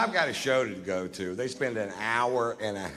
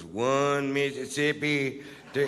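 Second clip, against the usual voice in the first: slow mock counting; 3 semitones higher, slower than usual. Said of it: "he goes".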